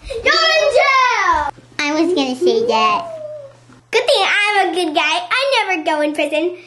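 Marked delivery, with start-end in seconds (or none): 0.1-1.5 high, slow drawn-out proclamation; playful jail announcement. Pretend. Officer telling waking prisoner where they are, high drawn-out announcement. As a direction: call it out high, stretching the last word.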